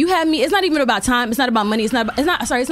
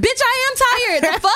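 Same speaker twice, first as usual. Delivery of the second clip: much higher, slow indignant cry; exasperated outburst; 11 semitones higher, slower than usual. Retold or imitated. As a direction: stretch it out in disbelief. Exasperated comeback to "you look tired.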